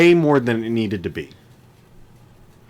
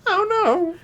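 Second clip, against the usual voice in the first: mock panic; much slower than usual. Mock fright, very high.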